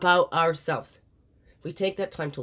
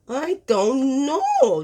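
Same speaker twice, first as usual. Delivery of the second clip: high, loud, very drawn-out shrug; exaggerated slow "dunno"; playful cluelessness; 6 semitones higher, 6 dB louder, much slower than usual. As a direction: stretch every word way out, high and playful.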